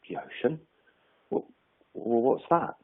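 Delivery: lightly acted puzzlement; puzzled question. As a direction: ask hesitantly, with a questioning rise. Frog puzzled by unknown word.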